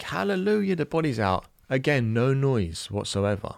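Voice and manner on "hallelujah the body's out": much higher, jubilant cry of relief; a father retelling his relief at the birth, high and joyful; relieved hallelujah. Retold or imitated. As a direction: throw up a retold hallelujah. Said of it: "and it's like".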